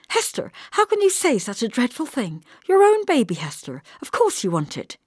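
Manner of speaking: a sister horrified, reproaching her; shocked. Acted. Shocked at dreadful words.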